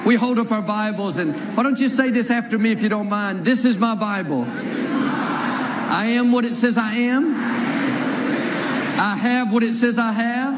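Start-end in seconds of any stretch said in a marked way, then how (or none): 3.4-10.6 slow, measured call-and-response declaration; unison Bible confession. Acted. Preacher leading the church in a slow confession. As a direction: declare each line slowly for the church to repeat.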